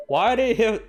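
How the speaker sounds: normal talking voice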